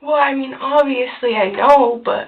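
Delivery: loud, rushed and flustered; blurting it out in a panic; panicked. Real, not acted. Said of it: "I'm in a panic".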